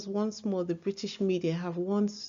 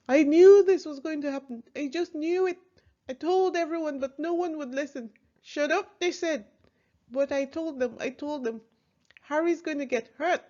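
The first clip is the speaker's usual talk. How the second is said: put-on a rough, higher-pitched Irish accent; 6 semitones higher than usual. Bodyguard wailing during the attack, Irish accent. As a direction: mimic the panicked Irish bodyguard. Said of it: "That's my best impression of, of an Irish, uh, accent".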